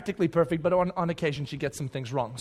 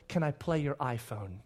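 put-on quiet, innocent little-girl request; small quiet ask; 5 semitones lower, 10 dB quieter than usual. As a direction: ask it softly and sweetly. Daughter's quiet request retold.

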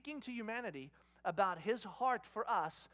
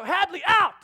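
loud high reenacted command; angry shouted order; 10 semitones higher, 17 dB louder than usual. Dad to woken older daughter, angry shouted order. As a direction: yell her out of the room.

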